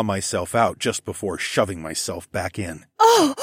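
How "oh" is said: loud, very high yelp. A narrator's reading. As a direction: yelp out sharply. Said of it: "Zoe yelped".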